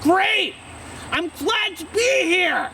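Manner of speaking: very high, drawn-out exclamation; shouty TV-guest enthusiasm. Acted. Answering an interviewer on TV, shouty enthusiasm. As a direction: belt it out high like an over-keen guest. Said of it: "Instead of just like".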